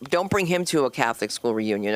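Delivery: ordinary talk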